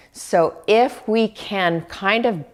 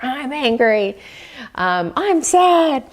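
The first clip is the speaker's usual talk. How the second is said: mock emotional voice, pitched much higher; loud emotions; 6 semitones higher than usual. Shown as a demonstration. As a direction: play the loud, insistent feelings a bit hammed-up. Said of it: "do they speak really loudly to you?".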